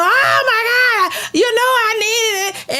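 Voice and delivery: high, slow, hammed-up sobbing cry; mock crying shout. Fake-surprised churchgoer mocked on the floor, slow high sobbing shout. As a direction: wail it like fake tears of relief. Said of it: "screaming and hollering".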